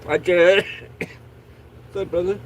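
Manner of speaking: coughing fit between words; stoner. Real, not acted. Coughing fit while talking, smoking.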